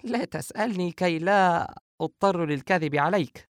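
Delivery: high, playful woman's voice; smiling retort. A narrator's reading. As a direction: dodge the question in a high, light voice. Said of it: "عائشة وهي تضع لقمة في فمها باسمة". (رد باسم بصوت عال)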